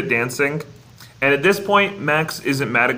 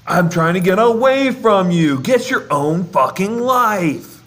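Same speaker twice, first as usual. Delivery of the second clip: higher, louder mock outburst at his dad; mock angry Max; 6 semitones higher, 4 dB louder than usual. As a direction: snap it back at Goofy in a hammed-up teen rant. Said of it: "Mac's like".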